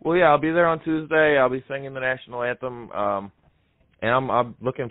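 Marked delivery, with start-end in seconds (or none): none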